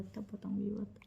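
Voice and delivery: hushed secretive whisper; whispered, teasing secrecy. Real, not acted. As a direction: breathe it out like a secret. Secret kept for a real fight.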